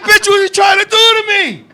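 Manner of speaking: high, loud mock protest; hammed-up alarm. Man to his girlfriend in bed, high hammed-up alarm. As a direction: cry out in comic disbelief.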